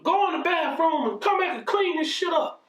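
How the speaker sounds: high, sharp command; mother ordering. Retold or imitated. Mother ordering her son to clean up the mess, high sharp command. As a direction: bark the order at him. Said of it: "She said".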